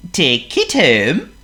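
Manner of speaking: high, loud, slow seal voice; Honk the seal. Acted. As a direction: play a polite silly seal. Honk's voice.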